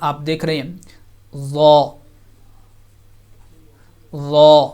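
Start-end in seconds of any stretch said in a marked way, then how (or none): 1.4-1.9 thick, full zaa sound; ظا said thick and heavy; heavy letter za. Shown as a demonstration. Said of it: "اس کو موٹا کر کے". 4.2-4.7 thick, full zaa sound; ظا said thick and heavy; heavy letter za. Shown as a demonstration. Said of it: "اس کو موٹا کر کے".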